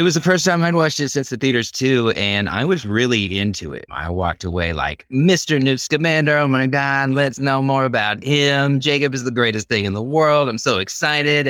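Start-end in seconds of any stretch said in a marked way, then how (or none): 5.1-11.5 mock the starstruck fan leaving the theater; squealing fangirl delight, hammed-up; gushing fan. Retelling fan reaction after movie.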